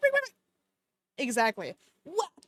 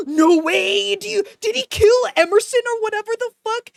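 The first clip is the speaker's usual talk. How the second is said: shocked shout; 6 semitones higher, 9 dB louder than usual. Real, not acted. Shocked by plot twist.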